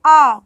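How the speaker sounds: A said as open "ah"; A as one long, loud "ah"; Spanish letter A. Shown as a demonstration. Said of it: "A is pronounced as".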